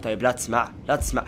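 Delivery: low hushed plea, voice dropped; hushed pleading. Real, not acted. Player pleading softly while hiding from the giant. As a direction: beg quietly so the giant can't hear.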